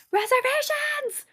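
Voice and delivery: very high-pitched, drawn-out squeal; sky-high mock holler; calling out. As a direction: call it out like paging lost friends. Re-enacts calling out for friends.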